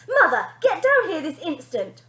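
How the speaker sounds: high, loud command; bossy daughter ordering her mother. Acted. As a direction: bark the order. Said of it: "ordered Bonnie".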